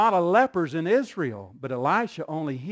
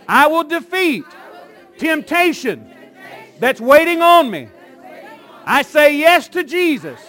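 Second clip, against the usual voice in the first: loud, high declarations with long pauses between phrases; rousing, shouted revival-style call; full-force preaching; 8 semitones higher, 10 dB louder than usual. Real, not acted. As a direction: proclaim each phrase for a congregation to echo.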